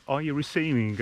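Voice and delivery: usual speaking voice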